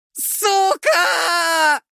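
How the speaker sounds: sudden scream of agony; pained scream. Acted. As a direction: howl it out in pain. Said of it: "заорал Макар".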